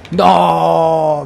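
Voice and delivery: long, loud cry of no; panicked shout. Real, not acted. Player sees the chaser closing in, panicked shout. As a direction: shout it out, drawn out.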